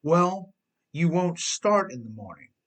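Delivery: hammed-up accusation of the car; mock exasperated outburst; exclaiming.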